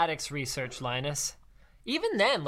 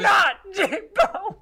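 high hammed-up wail of grief; mock wailing; 12 semitones higher, 5 dB louder, slower than usual. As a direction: wail as if heartbroken, overdone. Mock grief over dead robot.

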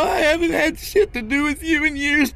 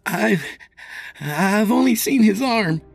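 high, faltering then louder; frightened stammer; 4 dB louder than usual. A narrator's reading. Scott telling the officer what he saw, frightened. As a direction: stumble on the start, then push it out.

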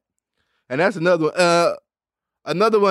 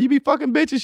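mock question; 10 semitones higher than usual. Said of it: "You be like".

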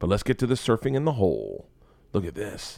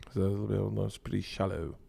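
lightly mimicked South African accent, softer; imitated South African accent; 7 dB quieter than usual. Host repeating the shark line to show off the accent, light South African mimicry. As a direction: echo the vlogger in a South African accent, quietly. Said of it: "Did you hear that?".

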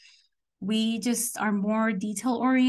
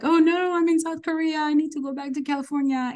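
higher-pitched mock-earnest objection; naive protest; 6 semitones higher than usual. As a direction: insist like your naive younger self. Mocking her own younger naive insistence.

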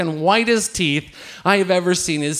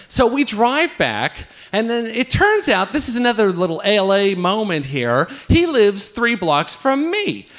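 usual speaking voice

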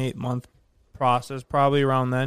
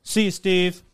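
quoted casual farewell; 4 semitones higher than usual. Retold or imitated. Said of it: "Mike goes".

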